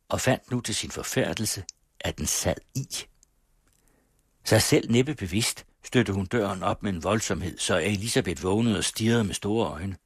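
ordinary delivery

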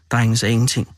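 usual speaking voice

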